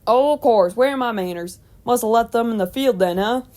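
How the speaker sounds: put-on jovial old farmer's country accent. A narrator's reading. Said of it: "in his country accent".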